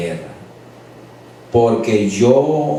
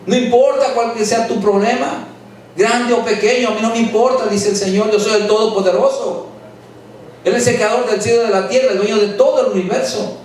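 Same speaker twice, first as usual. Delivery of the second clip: fervent preaching; 7 semitones higher than usual. Acted. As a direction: preach it out to the whole church. Preaching at full force to congregation.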